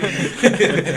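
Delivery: big real laugh, high and loud; a hearty high laugh; loud burst of laughter. Real, not acted. Laughs at the construction question.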